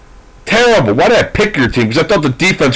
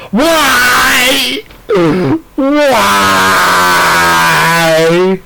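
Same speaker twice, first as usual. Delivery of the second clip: mock anguished wail; 3 semitones higher than usual. Fan wails at his team's winning coach, mock anguished cry. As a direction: wail in fake despair over the team. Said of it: "why I just made that noise".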